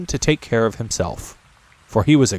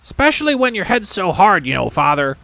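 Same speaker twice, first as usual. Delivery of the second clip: put-on young goblin son; 9 semitones higher than usual. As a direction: whine a little about the heavy chest.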